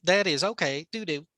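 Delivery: caricatured Chinese accent, word by word; broken-English Chinese accent. Shown as a demonstration. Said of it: "almost broken English".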